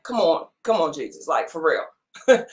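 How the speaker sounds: dropping low and soft, teasing disbelief; lightly mocking "come on, for real"; mock exasperated crowd. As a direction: imitate a crowd rolling its eyes at Jesus. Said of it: "they looking like".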